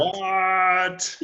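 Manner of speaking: loud, high, drawn-out disbelief; exaggerated shocked exclamation; mock outrage. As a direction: cry out as if scandalized. Mock outrage at disco remark.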